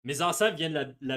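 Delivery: ordinary delivery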